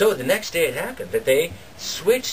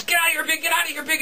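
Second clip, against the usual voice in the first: higher mock yell shooing a pig; 10 semitones higher, faster than usual. Farmer yelling at the pig in his milk, mock yell. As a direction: chase the pig out of the house.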